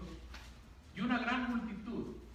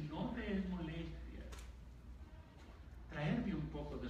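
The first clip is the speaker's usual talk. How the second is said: mock reverent prayer; 7 semitones lower, 7 dB quieter than usual. Preacher mocking overly polite believers praying to God, soft pompous reverence. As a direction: parody a stiff, pious churchgoer praying timidly. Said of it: "Hay que empezar diciéndole".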